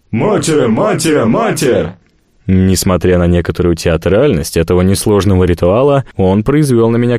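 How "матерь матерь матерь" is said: three high barked chants; barked war cry. Acted. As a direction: bark the salute like sailors. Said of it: "моряки гаркнули".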